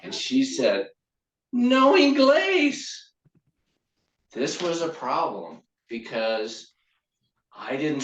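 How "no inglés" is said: put-on high, loud, drawn-out girl's voice; girl's reply. New girl answering the smitten boy, hammed-up girl's voice. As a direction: put on a high, slow Spanish-speaking girl.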